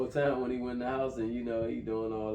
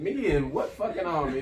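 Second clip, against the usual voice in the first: loud, high mock indignant retort; 5 semitones higher, 6 dB louder than usual. Fat friend refusing to lift his buddy, indignant. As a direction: scoff at the request.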